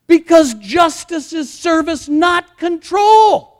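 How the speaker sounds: high, loud, indignant sermon; slow, shouted pulpit proclamation; full-force preaching. Real, not acted. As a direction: preach at the top of your voice, dragging out key words.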